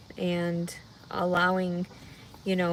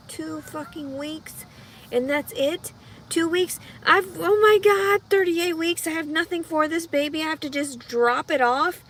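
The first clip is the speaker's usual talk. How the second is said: panicked; 8 semitones higher, 4 dB louder than usual. Real, not acted. Panic at two weeks left.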